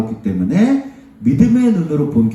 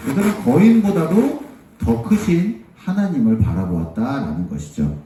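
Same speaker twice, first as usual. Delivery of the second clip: normal talking voice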